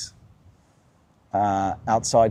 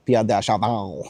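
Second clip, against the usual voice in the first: French accent. Shown as a demonstration. Host trying out the French pronunciation of "Pierre de Chardin" in a French accent. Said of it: "I think that's how you say it with a French accent".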